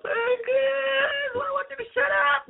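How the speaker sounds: very high, squealed pretend rant; hammed-up shrieking tirade; mock furious outburst. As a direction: blow up in a joking falsetto screech. Joke outburst, then "I'm joking.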